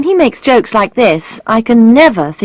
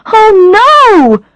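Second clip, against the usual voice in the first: high, loud, drawn-out horror; dismayed cry; 13 semitones higher, 7 dB louder than usual. A narrator's reading. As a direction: cry out as the mistake dawns on you. Coins roll everywhere, she cries out.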